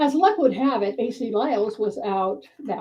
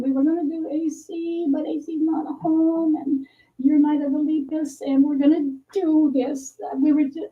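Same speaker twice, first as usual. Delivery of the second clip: high, pleading excuse; high-pitched explaining; 8 semitones higher than usual. Retold or imitated. Storyteller explaining the costumes to Carolyn, high and flustered.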